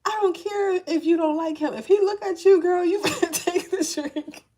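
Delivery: lightly played sassy elder; sassy spirit auntie. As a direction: order her like a blunt auntie. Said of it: "No, they like".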